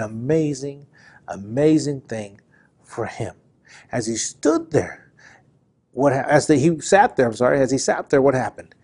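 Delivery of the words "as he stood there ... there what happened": ordinary talk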